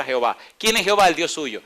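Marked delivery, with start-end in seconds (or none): 0.6-1.1 called out. Acted. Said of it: "preacher calls out".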